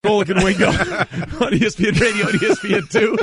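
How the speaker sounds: laughing. Real, not acted. Host laughs through station ID.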